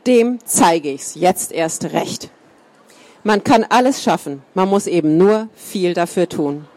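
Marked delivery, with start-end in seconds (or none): none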